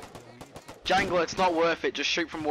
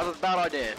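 ordinary delivery

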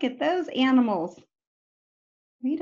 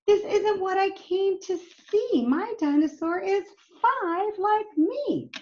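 put-on upset little boy; 7 semitones higher than usual. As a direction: play the boy who lost his dinosaur, pleading.

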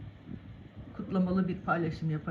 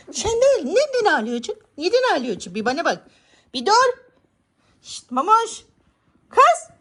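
high, loud coaxing of the cat; calm the upset cat, half scolding; high pet talk; 11 semitones higher, 7 dB louder than usual. Real, not acted. Owner coaxing her crying cat in high pet talk.

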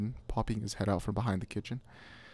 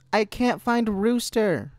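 high, slow, loud holler; yelling back; 13 semitones higher, 6 dB louder, slower than usual. A narrator's reading. Boy yelling back from near the silo about the lost dog. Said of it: "he yelled back".

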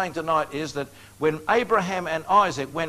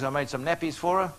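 normal talking voice

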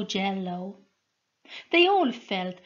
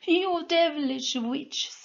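higher tearful cry; tearful shout; 7 semitones higher than usual. A narrator's reading. Soldier cursing Sol with tears, higher. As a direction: cry it out at the witch. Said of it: "shouted the man with tears".

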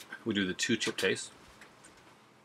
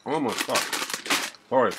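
talking while chewing spicy food; gasping from burning mouth; mouth full, chewing; 4 semitones higher, faster than usual. Real, not acted. Seen 2: bites chip at -5; cheeks full, chewing at start, later hand over mouth.

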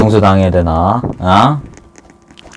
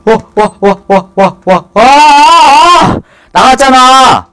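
drawn-out high yell, much higher and louder; excited scream; 12 semitones higher, 5 dB louder, slower than usual. Real, not acted. Streamer shocked by the game, screaming it out. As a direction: let the scream stretch out.

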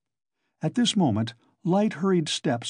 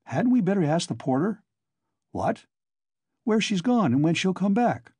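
put-on higher, eager young man; 5 semitones higher than usual. A narrator's reading. Young newcomer chatting to Coke, eager. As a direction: lift it like a cheerful youth.

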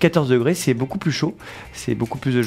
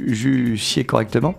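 ordinary delivery

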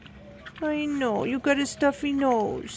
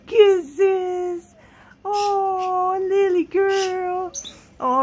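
sweet nothings; 4 semitones higher, 4 dB louder, slower than usual. Real, not acted. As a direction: gush over a beloved pet. Overjoyed with pet.

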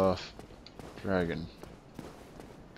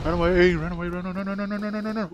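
panicked; 4 semitones higher, faster than usual. Real, not acted. Fleeing the dragon in panic.

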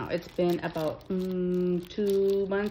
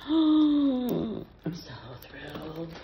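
drawn-out thrill; 5 semitones lower, much slower than usual. Real, not acted. Gasps over gift contents.

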